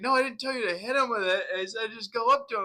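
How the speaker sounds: fast, high, loud protest; defensive instructor yell. Retold or imitated. Instructor denying he said to hit him, fast loud yell. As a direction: fire it back fast, caught and defensive. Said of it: "and he's like".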